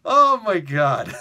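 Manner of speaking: loud, slow exasperated laugh; laughing exclaim. Real, not acted. Host reacting to his own joke, laughing. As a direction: groan it while laughing. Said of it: "laughing, loud slow".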